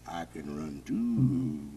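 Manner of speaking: very low, slow bear growl; a slow, menacing bear grinning. Acted. Bear grinning back at the woodchuck, very low. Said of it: "says the bear".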